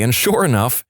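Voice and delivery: ordinary talk, nothing marked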